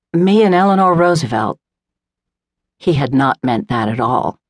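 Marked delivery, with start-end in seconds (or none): 0.1-1.6 wry retort. A narrator's reading. Lady's wry reply to horse remark.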